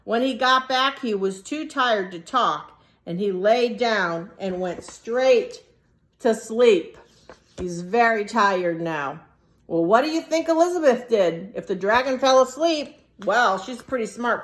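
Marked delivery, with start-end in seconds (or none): none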